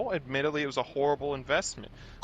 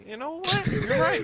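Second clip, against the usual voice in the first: very high squeal, rising louder; mock squeaky retort; 5 dB louder than usual. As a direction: squeak it out in comic exasperation. Acted retort, squeaked for laughs.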